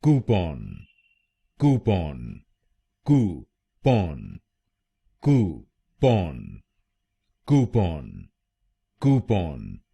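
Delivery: कूपन repeated as KOO-pon; कूपन slow and clear, stress on कू; model pronunciation. Shown as a demonstration. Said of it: "तो बनेगा".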